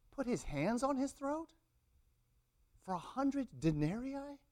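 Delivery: high, incredulous questioning; aghast, voice lifting right up; appalled disbelief. Real, not acted. Preacher appalled at the servant's behaviour.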